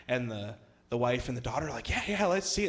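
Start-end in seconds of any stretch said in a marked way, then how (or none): 1.9-2.7 higher-pitched imitation of eager onlookers; eager agreement. Wife and daughter agree to watch the injury video, eagerly. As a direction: chime in keenly, pitched up.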